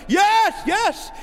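fan's shouted yes, yes; stadium cheer. Retold or imitated. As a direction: cheer like a fan in the stands. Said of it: "they're like".

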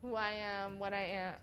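ordinary talk, nothing marked

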